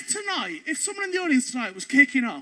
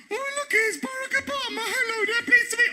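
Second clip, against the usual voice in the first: much higher, gushing tourist voice; mock cheerful greeting; 10 semitones higher than usual. Comedian greets the Obama waxwork in a high gushing voice. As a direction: gush at the waxwork like a fan. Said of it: "I was going".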